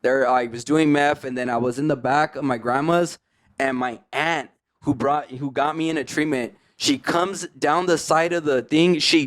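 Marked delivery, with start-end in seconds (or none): none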